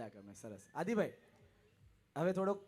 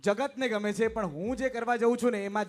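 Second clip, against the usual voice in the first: loud, higher proclamation to the hall; stage announcer; 4 semitones higher, 9 dB louder than usual. Acted. Host repeating the initiate's answer to the crowd, loud proclamation.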